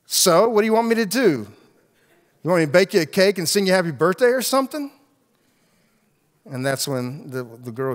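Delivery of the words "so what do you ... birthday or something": higher, louder and fast, scornful; mocking retort. Friend mocking the birthday girl, loud and sarcastic. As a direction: snap back sarcastically.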